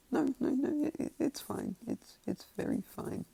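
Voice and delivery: high, stammering mock-nervous voice; nervous backpedalling. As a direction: placate them in a squeaky, anxious imitation. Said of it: "Kinda, like, nervously laughing".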